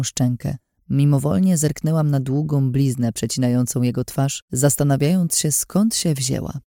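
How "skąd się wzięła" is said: ordinary delivery, nothing marked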